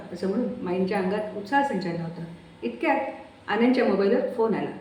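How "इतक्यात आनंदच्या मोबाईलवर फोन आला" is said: plain reading voice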